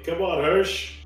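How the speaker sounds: usual speaking voice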